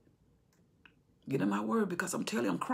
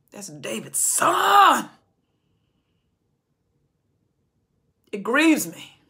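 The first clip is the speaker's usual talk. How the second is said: grieving; 4 semitones higher, 8 dB louder, much slower than usual. Acted. God grieving loudly.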